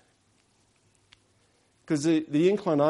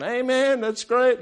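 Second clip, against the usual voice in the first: high, loud amen in support; churchgoer cheering the preacher; 12 semitones higher, 6 dB louder, slower than usual. Retold or imitated. Seated woman cheering on a street preacher, high loud amen. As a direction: call it out high like an onlooker. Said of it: "She's sitting there going".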